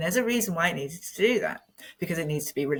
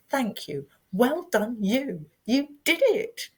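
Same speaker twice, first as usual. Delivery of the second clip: much higher, slow, tender praise to herself; self-praise; 7 semitones higher, slower than usual. Retold or imitated. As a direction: hug yourself with the words. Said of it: "and I said".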